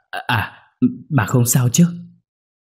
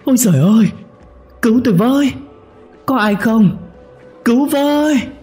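terrified screaming; 4 dB louder than usual. A narrator's reading. Husband dragged back by the ghost, terrified screaming. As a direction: wail for help at the top. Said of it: "chỉ kịp hét lên".

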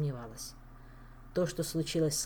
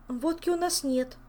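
frightened; 9 semitones higher, 3 dB louder than usual. Acted. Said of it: "испугалась Саэта".

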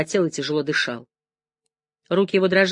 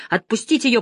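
higher, raised urgent call; shouted demand; 6 semitones higher, 3 dB louder than usual. A narrator's reading. Man in a wheelchair defends Janine, crying out. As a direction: call out to make them let go. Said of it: "крикнул он".

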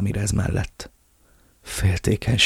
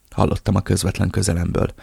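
ordinary delivery

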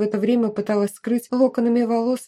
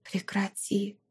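hushed, whispered inner plea; soft whispered begging; 9 dB quieter, slower than usual. A narrator's reading. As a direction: whisper it, barely voiced. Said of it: "шептала мысленно".

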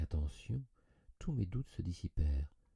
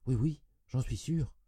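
higher, louder, hurried-sounding insistence; insistent assurance; 8 semitones higher, 6 dB louder than usual. A narrator's reading. Cousin answering after a struggle to remember, insistent. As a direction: insist a little too firmly.

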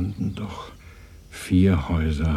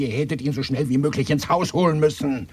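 high, loud scolding; angry reproach; 8 semitones higher, 6 dB louder than usual. Acted. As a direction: reproach them sharply. (höher und lauter, wütender Vorwurf)

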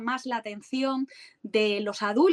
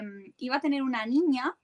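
usual speaking voice

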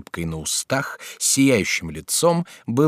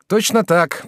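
eager; 9 semitones higher, 5 dB louder, slower than usual. A narrator's reading. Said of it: "подхватил Жак".

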